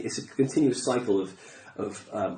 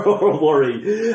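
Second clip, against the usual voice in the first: laughing; 5 semitones higher, 7 dB louder, much slower than usual. Retold or imitated. Laughs mid-quote about worry.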